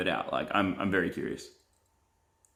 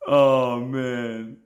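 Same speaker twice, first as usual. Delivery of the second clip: ordinary talk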